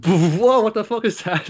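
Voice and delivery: high, loud startled whoa; hammed-up startled gasp at the monster; a student startled by a monster; mock shock. Retelling a shocked character.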